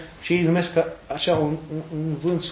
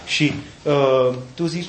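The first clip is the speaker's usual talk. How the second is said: ordinary talk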